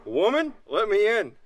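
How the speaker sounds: high loud plea, stress on woman; calling out. Real, not acted. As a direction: demand to be let in. Pleading at locked door.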